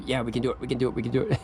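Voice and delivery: normal talking voice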